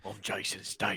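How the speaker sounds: lightly put-on English accent, soft and low; Jason Statham voice. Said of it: "that's how I can get in his voice".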